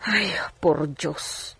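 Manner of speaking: hushed, slow breathy gasp; a slow frightened whisper; whispered worry. Acted. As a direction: murmur it to yourself in dismay. Gasped aside in worried scene.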